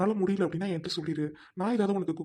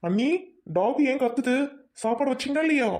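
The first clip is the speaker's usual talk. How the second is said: loud, high complaining yell; husband yelling about the barking dog; angry shout; 8 semitones higher, 7 dB louder than usual. Acted. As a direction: shout the question across the house.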